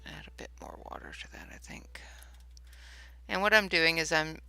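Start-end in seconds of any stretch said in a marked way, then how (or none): 0.0-2.0 whispered aside to herself; breathy unvoiced whisper to self. Real, not acted. Painting, muttering to herself.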